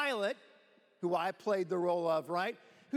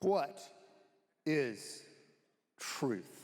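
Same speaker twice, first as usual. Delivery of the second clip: slow, word-by-word, sinking lower; Pilate; 7 semitones lower, much slower than usual. Acted. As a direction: drop each word after a weighty pause, acting the governor. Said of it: "I'm gonna be Pilate".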